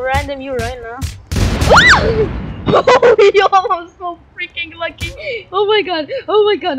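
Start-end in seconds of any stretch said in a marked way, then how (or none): 1.0-3.3 high, shrieking laughter; loud excited laugh. Real, not acted. Lucky escape in the game. 3.3-6.8 high, loud gushing over luck; worked-up, high-pitched amazement; excited disbelief. Real, not acted. Amazed at own luck in game.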